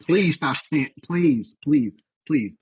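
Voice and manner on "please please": usual speaking voice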